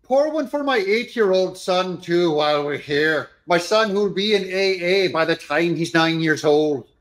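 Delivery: high hammed-up stage-Irish brogue; mock Irish accent. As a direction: ham up a pub-order in Irish brogue. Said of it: "That's Joe Scarborough making fun of every Irish".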